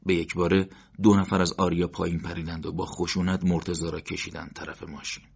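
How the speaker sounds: ordinary delivery, nothing marked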